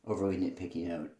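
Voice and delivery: ordinary talk, nothing marked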